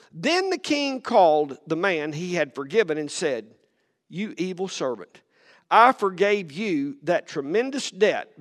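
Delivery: steady read-aloud of the parable; a preacher reading the Bible aloud; scripture reading. Acted. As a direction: read the passage to the church. Reads Matthew 18 aloud.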